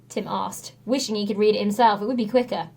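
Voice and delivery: ordinary delivery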